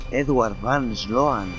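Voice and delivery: ordinary delivery, nothing marked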